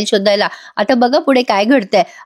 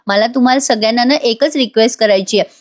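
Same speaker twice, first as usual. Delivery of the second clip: ordinary delivery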